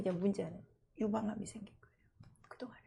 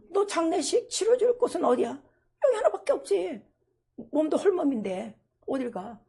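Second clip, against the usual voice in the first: loud mock exchange; 8 semitones higher, 11 dB louder than usual. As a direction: call it out high and loud. Acted exchange with church member.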